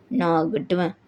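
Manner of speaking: very low, offhand retort; defiant husband. Acted. Husband answering wife's disbelief, defiant. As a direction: answer deep and unbothered.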